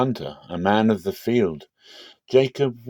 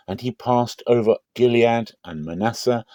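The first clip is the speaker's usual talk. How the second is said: ordinary delivery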